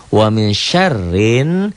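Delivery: "syarrin" run straight into a held n, no l; silent alif lam. Shown as a demonstration. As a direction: chant "Wa min syarrin" high and slow, no l sounded. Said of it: "alif lam ini tidak dibaca". ("syarrin" tanpa bunyi alif lam)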